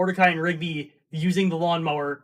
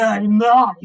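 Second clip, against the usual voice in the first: shouted punchline; 4 semitones higher, 9 dB louder than usual. Acted. Punchline of mom joke.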